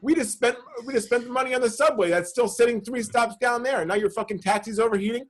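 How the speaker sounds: high-pitched mock rant at the driver. Still chewing out the taxi driver, high mock rant. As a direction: keep ranting at him, up high. Ranting at the cabbie.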